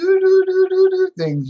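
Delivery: high sing-song doo-doo-doo, tossed off; playful high-pitched doo doo doo; mock gadget noise. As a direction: mimic a silly gadget jingle up high. Imitating a gadget's sound.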